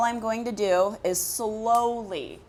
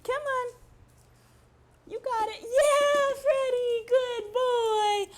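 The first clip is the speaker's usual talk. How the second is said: high-pitched praise voice; 11 semitones higher, slower than usual. Real, not acted. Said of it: "that high-pitched voice that I've been doing".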